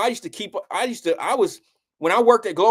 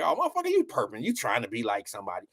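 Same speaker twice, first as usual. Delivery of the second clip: cynical mockery; 9 semitones lower, 7 dB quieter, faster than usual. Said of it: "this cynical way of seeing shit".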